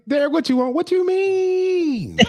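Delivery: put-on very high, slow whiny girlfriend voice; mock girlfriend. Co-host jokingly voicing Monique, high whiny mock. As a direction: squeal it like an exasperated girlfriend.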